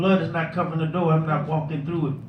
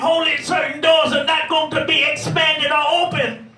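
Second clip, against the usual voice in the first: preaching at full force; 8 semitones higher than usual. Acted. Preacher lifting the congregation to the big blessings. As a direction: whoop it up like a revival preacher.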